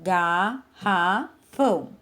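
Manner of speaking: GARRAFÃO in three slow, separate beats, "gar - ra - fão"; dividido em sílabas. Shown as a demonstration. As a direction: say Garrafão syllable by syllable, with pauses. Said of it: "quantas partes tem a palavra". (Garrafão separado em sílabas, gar... ra... fão)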